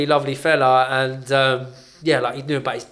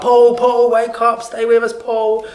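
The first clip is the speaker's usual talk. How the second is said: much higher, louder urgent calls of the name; retold doctors shouting; 10 semitones higher, 6 dB louder, slower than usual. Doctors shouting at a fitting patient on the ward, urgent loud calls. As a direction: shout the name to pull him back. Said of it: "ten doctors screaming his name".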